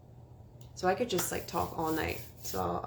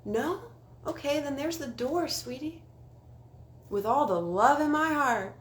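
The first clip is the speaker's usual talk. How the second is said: high, sugary mock-sweet voice; sassy sweetie brush-off; 8 semitones higher than usual. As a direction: sweetly show them the door. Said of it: "I say".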